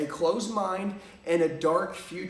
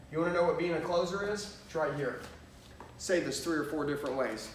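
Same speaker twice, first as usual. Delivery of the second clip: ordinary talk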